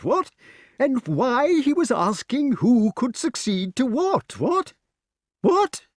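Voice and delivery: very high, squawky agitation; high excitement. A narrator's reading. Pellinore explains the succession problem, very excited. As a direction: gabble excitedly with rising little tag questions. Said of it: "cried King Pellinore in high excitement".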